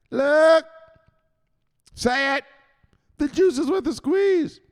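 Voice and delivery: very high, loud commanding shout; Richetta. Acted. Enforcer catching someone selling, loud high shouted commands. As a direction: shout the orders and slogan. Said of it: "And he'll tell you right there".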